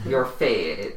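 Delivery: "fate" said with a clear long a; patient correction of "fate"; corrected fate. Acted. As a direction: stress the vowel of "fate" to correct. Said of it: "Oh, your fete".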